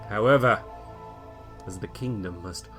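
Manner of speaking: ordinary delivery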